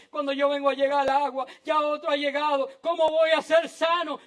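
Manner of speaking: exasperated excuse, high and hurried; protesting sick man. Acted. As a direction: object in a rush, climbing to a loud question. Acts out the sick man's complaint.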